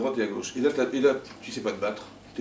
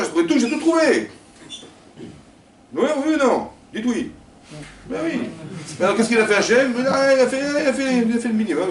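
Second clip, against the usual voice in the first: high, loud bragging; a businessman boasting he did the work; boastful self-credit; 12 semitones higher, 8 dB louder than usual. Acted. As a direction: brag that you did everything.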